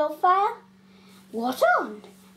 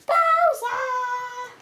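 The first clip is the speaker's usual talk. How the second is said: sung-out call across the room; 10 semitones higher than usual. Acted. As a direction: call him over in a sing-song. Calls Bowser over.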